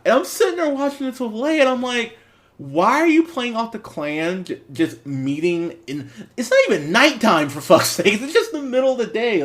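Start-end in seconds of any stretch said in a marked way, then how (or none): 2.6-9.4 high-pitched, incredulous rant; exasperated disbelief. Retold or imitated. Reviewer recalls objecting to the Klan gag, exasperated. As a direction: protest loudly, pitch riding high.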